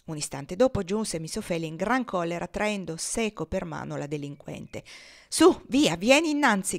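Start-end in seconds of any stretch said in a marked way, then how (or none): 5.3-6.8 high, loud scolding shout. Acted. Miss Ophelia dragging Topsy to her master, shouting high and loud. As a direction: yank the girl forward with your voice. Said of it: "gridava ella".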